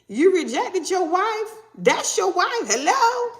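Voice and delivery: high-pitched, indignant scolding; angels screaming accusations. Acted. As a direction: confront them, high and insistent. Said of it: "They got multiple angels screaming at them".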